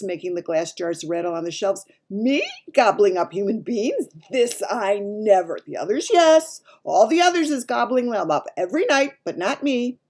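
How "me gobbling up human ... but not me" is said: loud, raised protest; shouting, indignant giant. A narrator's reading. Giant denying he eats humans, loud and indignant. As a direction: protest loudly in a mighty giant voice. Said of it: "shouted the giant, his mighty voice".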